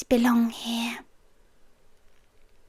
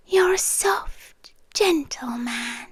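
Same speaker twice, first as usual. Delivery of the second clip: tender whisper; 6 dB quieter than usual. Acted. Intimate roleplay, tender reassurance.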